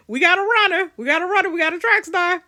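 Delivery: loud, high play-by-play holler; mock alarm. Real, not acted. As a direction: sound the alarm on a runaway, jokingly. Mock alarm as Beth runs off.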